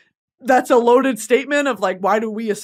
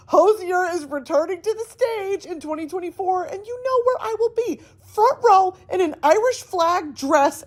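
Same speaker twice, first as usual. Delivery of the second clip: bright upbeat sponsor read; 5 semitones higher than usual. Acted. Telling listeners about the ticket app, upbeat ad read. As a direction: sell it like a host plugging a sponsor.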